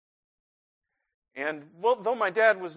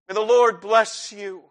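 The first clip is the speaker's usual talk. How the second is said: strong blessing; 3 semitones higher, 8 dB louder than usual. Acted. Benediction, strong.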